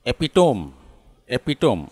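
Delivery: एपिटोम in three syllables, ending '-tome'; wrong pronunciation. Shown as a demonstration. Teacher showing the word people get wrong, mispronounced. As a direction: say एपिटोम, e-pi-tome, with silent final e. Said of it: "जिसको गलत से बोलते हैं".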